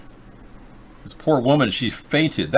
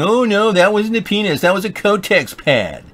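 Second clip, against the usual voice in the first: higher, whiny mock denial; mocking the deniers; 9 semitones higher than usual. Host mimics people denying the video, higher and mocking. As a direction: parody someone insisting it's nothing. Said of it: "we got people denying it, saying".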